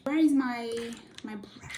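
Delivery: usual speaking voice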